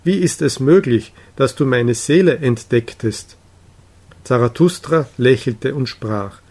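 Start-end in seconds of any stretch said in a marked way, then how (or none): none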